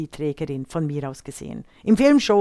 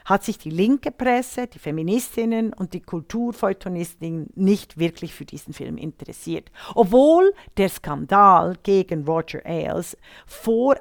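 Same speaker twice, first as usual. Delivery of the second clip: normal talking voice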